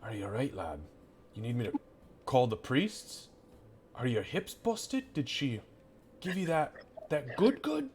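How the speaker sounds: soft confidential butcher, pitch climbing toward the end; teasing butcher. Acted. Butcher leans over to tease Gray, softly suggestive. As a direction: lean in quietly, drawing out the innuendo.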